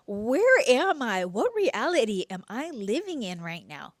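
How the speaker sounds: loud incredulous cry; disbelief. Retold or imitated. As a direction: blurt it out stunned by the answer. Retold disbelief at friend's reply.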